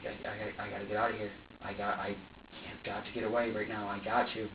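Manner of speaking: low, subdued, halting urge to escape; desperate self-talk. Retold or imitated. Fidgety amid the baby's screaming, quietly desperate. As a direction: stammer it low, as if to yourself. Retold need to escape, under breath.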